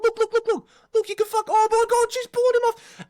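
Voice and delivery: high, loud excited yelling; excited crowd. Retold or imitated. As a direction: yell like a crowd that can't believe its eyes. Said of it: "a bunch of people just going".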